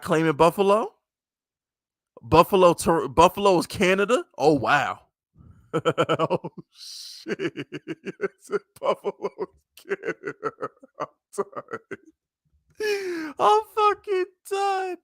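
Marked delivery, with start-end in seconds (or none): 5.7-15.0 words breaking up in laughs, soft then climbing very high; real helpless laughter. Real, not acted. Cracking up at chat's Buffalo joke, helpless laughter. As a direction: wheeze the words out through laughter.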